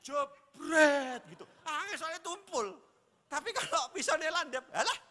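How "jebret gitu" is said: loud, slow jebret imitating a hard chop; chopping sound effect. Shown as a demonstration. Pastor imitating chopping meat with a dull knife, loud effect. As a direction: smack out the chop. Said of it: "meh ngetok apa itu, daging".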